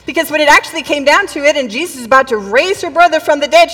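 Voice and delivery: ordinary delivery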